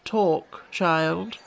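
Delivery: mildly dreamy command. A narrator's reading. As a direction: give the order as if half in reverie. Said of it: "looked dreamily at Alice".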